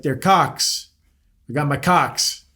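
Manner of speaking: "cocks" for Crocs, with no r; child's mispronunciation. Retold or imitated. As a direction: imitate a toddler proudly saying "cocks" without the r. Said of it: "he was trying to say Crocs".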